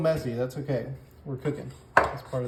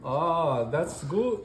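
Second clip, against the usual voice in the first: put-on higher, half-hearted German accent; attempted German accent; 3 semitones higher, much slower than usual. Cook attempts German over the enchiladas, weak German accent. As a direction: try a German accent and barely manage it. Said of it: "I'm trying to do German".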